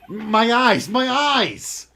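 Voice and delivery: high shouted mock pain; wailing about stinging eyes; screaming.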